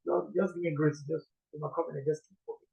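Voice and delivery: a hushed prayer to keep going; quiet prayer. Retold or imitated. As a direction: pray under the breath for grace.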